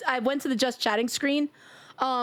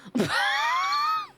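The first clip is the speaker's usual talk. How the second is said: squealing laugh; high shrieking laugh. Real, not acted. Streamer laughing at a game mishap, squealing laugh.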